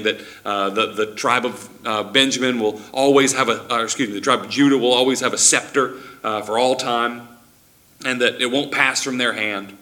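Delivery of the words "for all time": ordinary delivery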